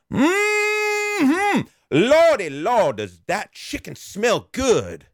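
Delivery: high, slow hammed-up drawl of a beggar; hammed-up old beggar begging for chicken. Beggar voiced in retold story.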